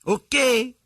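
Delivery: high, slow eager man's voice; excited husband voice. Retold or imitated. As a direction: sound giddy to try it.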